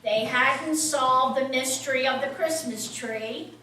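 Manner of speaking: ordinary delivery, nothing marked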